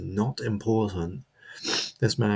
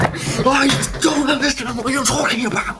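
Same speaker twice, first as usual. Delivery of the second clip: much higher, louder, put-on innocent voice; mock innocent; 7 dB louder than usual. [name] plays innocent to the crowd in a squeaky mock voice. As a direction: play dumb in a squeaky voice. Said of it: "I just stood up going".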